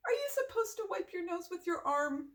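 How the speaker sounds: usual speaking voice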